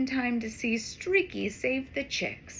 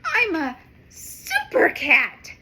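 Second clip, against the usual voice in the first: louder, slow proud cat voice; proud cry; 4 semitones higher, 6 dB louder than usual. Acted. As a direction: crow it out proudly. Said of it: "cries Streaky".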